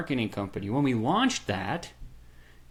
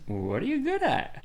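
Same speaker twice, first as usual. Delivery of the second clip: mentor's pointed question; 10 semitones higher, slower than usual. Retold or imitated. Quoting Russell's question.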